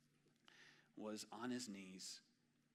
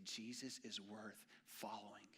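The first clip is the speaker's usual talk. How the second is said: softer, slow whispered conviction; hushed earnest plea; 8 dB quieter, slower than usual. Acted. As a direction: whisper it like a secret truth. Preacher urging next generation.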